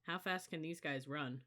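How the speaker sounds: ordinary delivery, nothing marked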